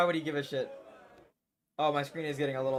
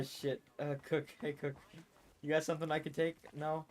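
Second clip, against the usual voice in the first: ordinary talk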